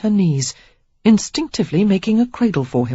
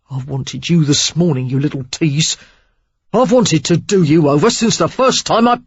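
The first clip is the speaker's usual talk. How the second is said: rough man; 4 dB louder than usual. Acted. Derek glaring, frustrated.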